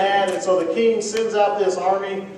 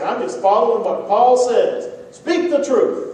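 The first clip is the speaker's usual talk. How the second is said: raised, louder blunt voice quoting Paul; mock blunt retort; 7 semitones higher, 6 dB louder than usual. A blunt man snapping back at critics, raised and loud. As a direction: play the rude know-it-all, pushing hard.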